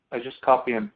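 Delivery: ordinary delivery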